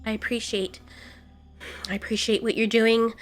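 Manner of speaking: hushed, halting thanks; soft appreciation. Acted. Fang thanking mate for restraint, hushed and soft. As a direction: thank gently, barely above a murmur.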